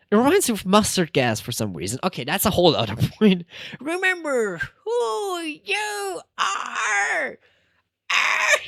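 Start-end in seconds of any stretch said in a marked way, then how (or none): 3.8-7.4 much higher, slow, drawn-out shout; dramatic meme shout. Pretend. Bursting into the meme quote for chat, drawn-out shout. 8.1-8.5 sudden squeal, much higher; high shriek. Pretend. Ending the meme bit for chat with a squeal.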